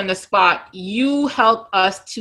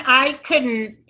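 normal talking voice

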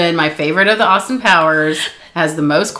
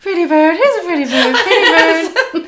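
parrot voice; 8 semitones higher than usual. Retold or imitated. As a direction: coo it like a pet parrot. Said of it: "Has good quotables".

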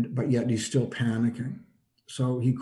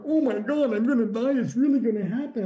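much higher, panicked cry of fear; imitating panicked Wayne; 11 semitones higher than usual. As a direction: replay his terror, voice jumping way up. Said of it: "He started crying and freaking out".

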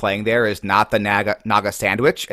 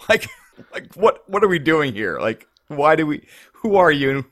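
higher-pitched, baffled retelling; mock exasperation; 5 semitones higher than usual. Player recalling a pointlessly long game, mock exasperated. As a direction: question it in half-serious disbelief.

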